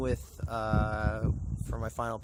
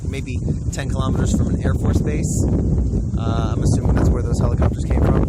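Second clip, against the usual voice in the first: loud over strong wind; shouting over wind; 2 semitones higher, 15 dB louder than usual. Real, not acted. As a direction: raise your voice to carry over wind. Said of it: "I sure hope you can hear me over this wind".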